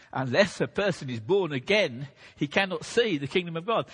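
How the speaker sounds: loud, emphatic pulpit voice; forceful preaching. Acted. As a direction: proclaim it from the pulpit.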